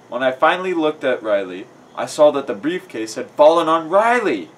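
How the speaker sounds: ordinary delivery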